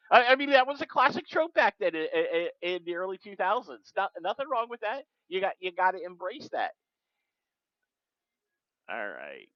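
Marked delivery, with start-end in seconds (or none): 0.1-6.7 much higher and louder, eager; excited. Real, not acted. Reader defending the zombie trope, excited. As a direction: gush about the cheesy trope.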